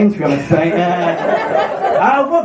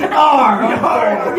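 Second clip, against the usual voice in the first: hammy pirate "arr"; Captain Birdseye. Acted. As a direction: growl it like a comic sea captain. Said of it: "I sound like Captain Birdseye".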